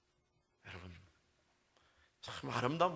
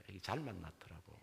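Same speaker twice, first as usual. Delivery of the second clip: ordinary delivery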